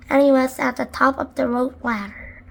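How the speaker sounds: ordinary delivery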